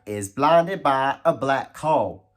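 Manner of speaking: slow, loud Texan drawl; big put-on Texas interviewer voice; Texas accent. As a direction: stretch it out like a hammy Texan host. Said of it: "talk in your normal Texas accent".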